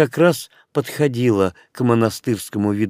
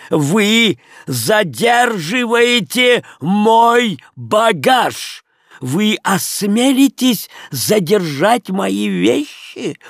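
намного выше и медленнее, грозно; кричать так, чтобы противник отступил; грозный крик; 10 semitones higher, 3 dB louder, much slower than usual. Acted. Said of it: "крикнул Леон таким голосом". (Леон устрашающе кричит на хозяина)

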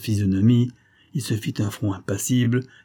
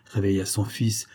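usual speaking voice

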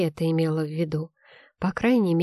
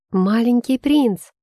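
high, slow gasp of alarm; 5 semitones higher, 6 dB louder, slower than usual. A narrator's reading. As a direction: gasp it out in shock. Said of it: "ахнула она".

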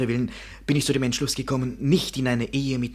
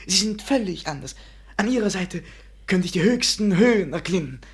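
ardent declaration; 6 semitones higher, 4 dB louder than usual. Acted. Passionate proposal to Celia.

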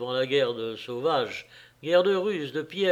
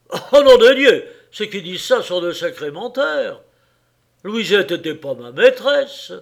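loud, furious shout, much higher; burst of rage; 6 semitones higher, 9 dB louder than usual. Acted. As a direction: explode at the liars. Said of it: "Un sursaut de rage emporta le carrier".

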